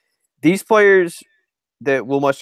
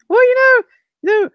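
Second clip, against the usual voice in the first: very high-pitched mimicking voice; Spurrier; 3 dB louder than usual. Imitating the coach in a sideline interview, really high-pitched. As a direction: put on a squeaky-high coach's voice. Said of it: "he talks in that really high-pitched voice".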